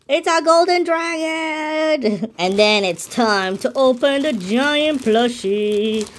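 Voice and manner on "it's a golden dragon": high, slow, stretched-out proclamation; excited announcement. Real, not acted. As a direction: hold "golden dragon" high and long like a big reveal. Answers own question with the reveal.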